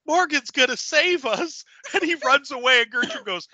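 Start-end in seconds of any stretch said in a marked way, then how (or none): 0.1-1.6 mock excited cheer. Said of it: "and Alva goes".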